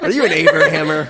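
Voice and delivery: asked through a laugh; giggling while asking it. Real, not acted. Repeats question laughing.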